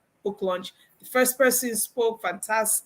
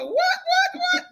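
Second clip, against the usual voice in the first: stunned what; slower than usual. Retold or imitated. Her stunned reaction retold.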